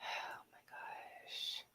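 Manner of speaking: breathy whisper of disbelief; whispered groan. Real, not acted. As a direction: sigh it out under your breath. Groaning at a bad pun.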